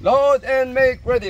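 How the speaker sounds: loud, slow range officer call; range command. Real, not acted. Range officer calling shooters to load, loud and slow. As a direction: call the line to load, drawn out.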